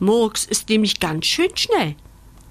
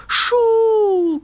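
high, loud ghostly "Schuh"; hollow sound; 6 dB louder than usual. Acted. A spooky noise from a hollow tree trunk, eerie call. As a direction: make it sound like it comes from inside a hollow trunk. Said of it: "klingt es dumpf aus einem hohlen Baumstamm".

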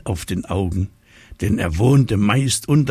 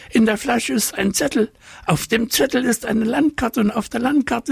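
very high fish voice; eager, tumbling fish chatter; high squeaky talking fish. A narrator's reading. Fish tells of treasure.

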